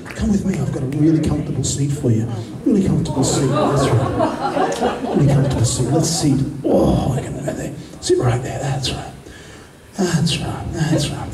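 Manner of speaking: low, soothing hypnotist patter; hypnotic induction. Acted. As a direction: lull them toward the chair. Hypnotist talks volunteers into seat.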